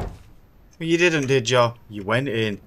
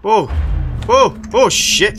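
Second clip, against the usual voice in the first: high, loud shocked yelp; jolted by a jump scare, yelling; startled yell; 8 semitones higher, 8 dB louder than usual. Real, not acted. Gamer startled by a jump scare, yelling out loud.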